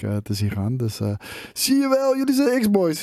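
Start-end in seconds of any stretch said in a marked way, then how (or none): 1.5-3.0 high-pitched imitation of accusing viewers; mock accusation. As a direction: put on a high, gotcha voice. Acted accusation from panicking viewers. (hoog stemmetje, spottend beschuldigend)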